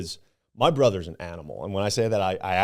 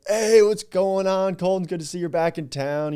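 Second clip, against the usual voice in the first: friendly townsperson greeting; 12 semitones higher, 8 dB louder than usual. Retold or imitated. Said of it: "Somebody's like".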